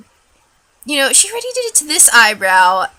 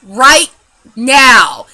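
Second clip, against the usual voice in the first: loud, slow, emphatic retold rant; pissed-off ranting; 7 dB louder, slower than usual. Retelling a rant to her friend, pissed off. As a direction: declare it angrily, drawing out each word. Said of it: "pissed off, and I was ranting".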